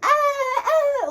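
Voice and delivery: very high imitated squeal; short, high mock screams; mock girlish scream. Girls running around with boys, mock screaming. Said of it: "They're screaming".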